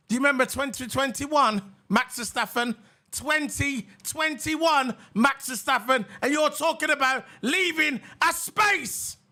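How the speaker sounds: high, sarcastic taunt at the rival driver; mocking taunt. Real, not acted. Streamer taunting the rival driver, high and sarcastic.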